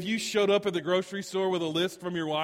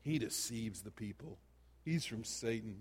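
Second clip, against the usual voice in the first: low, soft muttered accusation; muttering; 6 semitones lower, 8 dB quieter than usual. Retold or imitated. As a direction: mutter it under your breath. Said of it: "they're muttering".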